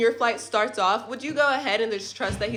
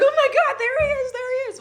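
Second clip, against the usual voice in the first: excited squeal; 4 dB louder than usual. Retold or imitated. Spotting husband at the airport.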